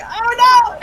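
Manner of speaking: put-on startled cry of dismay at a crying boy; mock alarm. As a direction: yelp it like you just scared a kid. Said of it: "And I was like".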